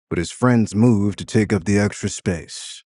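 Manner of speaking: ordinary delivery, nothing marked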